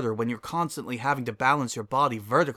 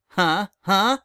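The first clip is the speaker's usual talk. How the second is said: playful taunt; 8 semitones higher, 8 dB louder than usual. Acted.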